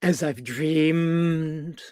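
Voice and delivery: very slow, last word held long; drawn-out modelling. Shown as a demonstration. Coach models a line for the partner to echo, very slow and sustained. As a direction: stretch it out dreamily.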